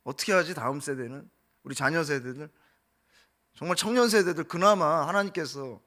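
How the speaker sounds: ordinary talk, nothing marked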